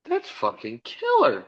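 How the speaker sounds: very high and slow, savoring the praise; awed excitement. Retold or imitated. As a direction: stretch it out way up high in awe. Watching the old video.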